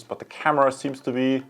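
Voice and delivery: normal talking voice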